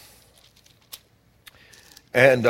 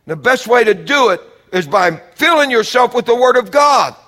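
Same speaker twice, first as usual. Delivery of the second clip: loud, high-pitched, driving exhortation; full-force preaching; 12 semitones higher, 9 dB louder than usual. Real, not acted. Preacher urging congregation to cleanse themselves, loud and high. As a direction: preach it at the top of your voice.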